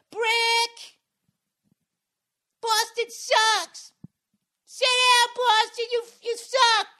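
put-on very high, loud screechy child yell; heckling little kid. Kid heckling the Celtics at a game, shrill yelling. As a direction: imitate a small boy shouting insults from the stands. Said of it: "little kid voice, seven, eight-year-old".

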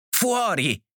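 high-pitched yell without much volume; frenzied cry. A narrator's reading. Markey's wife throwing the guest out, frenzied cry. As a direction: cry it out frantically, pitch jumping up. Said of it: "gridò la Markey con frenesia".

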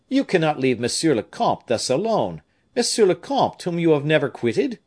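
high, loud, emphatic protest; objecting. A narrator's reading. Raoul urging servant to stay with the comte, objecting. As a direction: protest with the voice leaping up midway. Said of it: "again objected Raoul".

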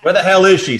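loud, high gruff cop shout; hammed-up noir cop yell; mock bellow. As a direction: holler it like a hard-boiled cop. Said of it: "said the sotto voce, crooning".

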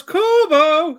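high, loud, drawn-out call; called-out name. Acted. Announcing who goes there, called-out name. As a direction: belt the name out mid-sentence.

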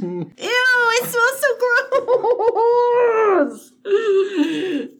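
drawn-out high disgusted ew. Real, not acted. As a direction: recoil from the smell. Smelling the purple Oreo.

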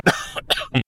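a real cough from the chest; chesty cough. Real, not acted. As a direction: cough once, heavy and breathless. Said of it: "Scusate la tosse". (tosse di petto)

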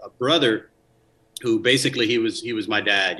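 ordinary delivery

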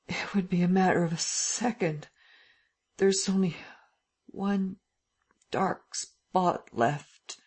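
slow, strained despair; anguished struggle. A narrator's reading. Lupin despairing over his lost memory, anguished and slow. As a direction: fight for every thought.

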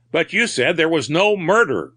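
a mild outcry of disbelief; crying out. A narrator's reading. Man challenging the captain's contradiction, crying out mildly. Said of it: "cried Corcoran".

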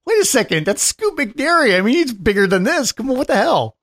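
half-playful double take; surprised. Retold or imitated. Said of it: "I was like".

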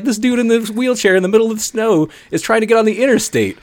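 high-pitched incredulous retelling; freaked out. Retold or imitated. Telling passengers about the man in a wheelchair, freaked out. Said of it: "freaking out".

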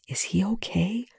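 hushed, breathy question; soft whisper. A narrator's reading. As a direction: whisper it across the room. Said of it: "Eli asked softly".

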